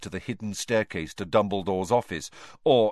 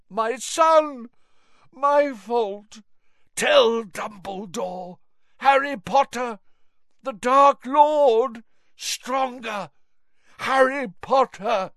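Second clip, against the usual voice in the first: high, slow, feverish pleading; delirious old man; 14 semitones higher, 6 dB louder, slower than usual. Acted. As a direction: rave like a man half out of his mind.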